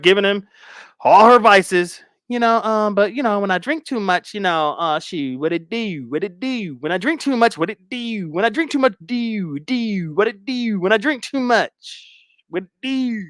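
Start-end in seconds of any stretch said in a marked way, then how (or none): 2.3-13.2 higher-pitched sing-song tipsy rambling; Brooke. Acted. Guest running her mouth about drinking, high sing-song mockery. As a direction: ramble in a high mocking voice, stretching "do". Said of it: "she gonna start running her mouth".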